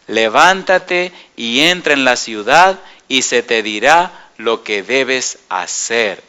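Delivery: slow and grave, handing down an order; slow commanding words. Acted. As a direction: say it like a calm divine command. The Lord gives Saul his orders. (orden lenta y solemne del Señor a Saulo)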